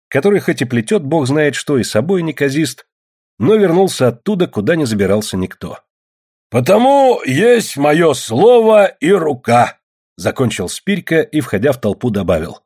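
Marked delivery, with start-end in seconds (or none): 6.5-9.8 loud, high, drawn-out proclamation; boastful declaration. A narrator's reading. Spirka ends his speech to the villagers, boastful declaration. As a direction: proclaim it slowly to the whole crowd.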